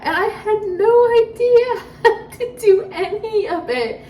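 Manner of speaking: laughing. Real, not acted. Laughing at her old self.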